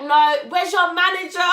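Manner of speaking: high, loud and indignant; demanding shout. Retold or imitated. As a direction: demand the manager at full volume. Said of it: "Like, I was proper angry".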